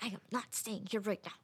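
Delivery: retold furtive protest, kept quiet; hushed refusal. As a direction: say it softly so the host won't catch it. Hushed aside to mom in English.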